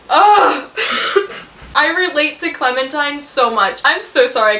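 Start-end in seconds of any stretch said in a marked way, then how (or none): 0.1-0.7 high, loud overwhelmed ugh; real exasperated groan; loud emotional groan. Real, not acted. 0.7-1.7 high, airy laugh; real embarrassed laugh; breathy high laugh. Real, not acted. Laughs after emotional groan.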